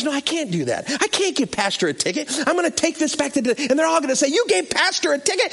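high-pitched, fretting mock panic; imitated distress. Cop dreading ticketing his pastor, mock agitated.

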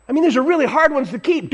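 normal talking voice

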